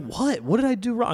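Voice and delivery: high, loud mock panic; mock alarm. Young comic asking the friend what he did wrong, mock alarmed. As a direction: ask what went wrong, playing up the fright. Retelling own alarmed reply.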